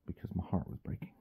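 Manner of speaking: fast, whispered confession. Real, not acted. Owner admitting to viewers in a whisper that he gave in to the dog. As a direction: breathe it out in a whisper.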